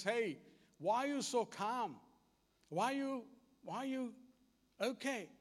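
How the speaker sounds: a lightly voiced scared servant; frightened servant.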